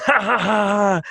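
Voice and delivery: high, drawn-out performed laugh; mock guffaw, stretched out; acted big laugh. Player showing the character laughing at the table, acted high laugh. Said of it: "I am laughing, like, laughing, laughing".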